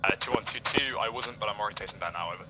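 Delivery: crackly radio voice over speaker; coming through a handheld radio; toy walkie talkie. Real, not acted. Radio reply from another team.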